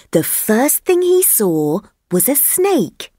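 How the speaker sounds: plain reading voice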